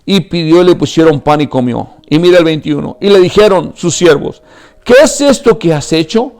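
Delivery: high, loud, impassioned sermon; preaching at full force. Acted. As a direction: pour it all out to the congregation. (predicación a todo pulmón, aguda y fuerte)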